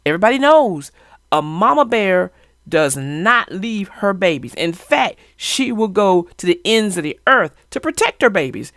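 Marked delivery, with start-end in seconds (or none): none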